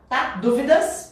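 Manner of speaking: normal talking voice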